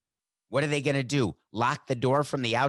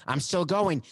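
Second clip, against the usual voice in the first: high-pitched defiant declaration; insist, voice jumping high; emphatic insistence; 6 semitones higher than usual. Acted. Player arguing past security to reach the field, emphatic. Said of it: "Was that emphatic?".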